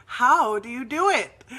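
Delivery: loud, high, insistent question; exasperated bafflement. Retold or imitated. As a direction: demand an answer in mock exasperation. Acting out her baffled, insistent question.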